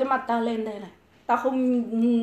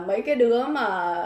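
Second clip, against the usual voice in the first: usual speaking voice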